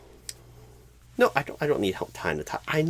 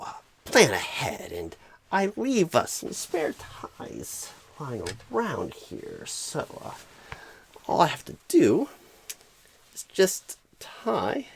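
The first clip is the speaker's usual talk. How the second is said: ordinary talk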